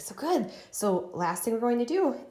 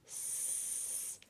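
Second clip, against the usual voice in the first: whispered spelling; 18 dB quieter than usual. Shown as a demonstration. Teacher whispering letters while writing. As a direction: softly whisper out each letter. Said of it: "Let's tap it out".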